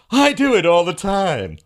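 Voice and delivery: high, loud, slow smug voice; mock Scott's boast with a drawn-out sneer; prejudicial mocking. Mocking Scott's boast to Mike, loud and slow. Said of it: "that was prejudicial mocking".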